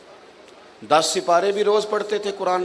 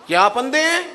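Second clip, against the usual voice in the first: put-on cranky old man's retort; 8 semitones higher than usual. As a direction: snap back like a rudely woken elder. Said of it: "اس بزرگ نے بھی یہی جملے کے کہا". (جاگے ہوئے بزرگ کا تلخ، اونچا جواب)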